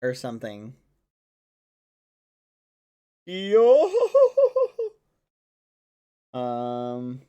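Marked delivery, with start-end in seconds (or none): 3.2-3.9 loud, high hooting laugh; Brook from One Piece. Acted. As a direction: belt out a cartoon pirate's laughing cry. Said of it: "Brooke from One Piece".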